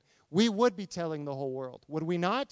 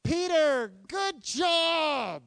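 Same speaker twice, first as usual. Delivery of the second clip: mock cheering Jesus; 8 semitones higher, much slower than usual. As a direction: cheer him on like a coach. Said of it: "He didn't say that".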